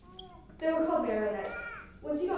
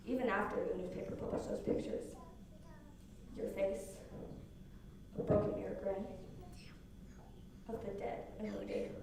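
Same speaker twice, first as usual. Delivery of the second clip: spoken-word poem; 5 dB louder than usual. Acted. Girl speaking to her shooter boyfriend in a spoken-word poem. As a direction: perform it like a slam poem.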